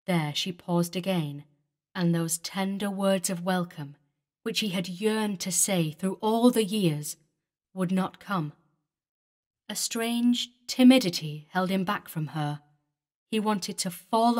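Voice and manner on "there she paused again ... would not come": ordinary delivery, nothing marked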